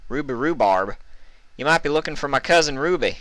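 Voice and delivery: high, quick country mare voice; Applejack voice. A narrator's reading.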